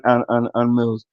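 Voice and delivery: ordinary delivery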